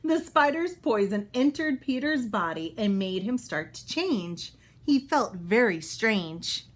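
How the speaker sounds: bright, clear storybook read-aloud; reading to children. Acted. Teacher reading a Spider-Man book to kids, storybook voice. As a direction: read it out for young listeners.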